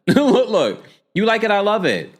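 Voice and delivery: laughing. Real, not acted. Laughing repeat of her own quip.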